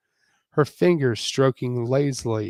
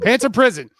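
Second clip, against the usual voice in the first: mocking echo; 10 semitones higher, 9 dB louder, much faster than usual. Mock-dramatic repeat of misread word.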